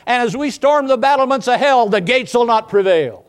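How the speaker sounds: preacher storming the gates of hell; full-force preaching. Acted. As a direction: thunder it from the pulpit like a battle cry.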